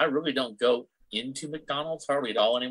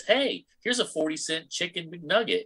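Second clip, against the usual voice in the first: high, drawn-out, tempting offer; perky sales pitch; 7 semitones higher than usual. Retold or imitated. App luring customer in with a deal, perky. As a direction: chirp it like a pushy app notification.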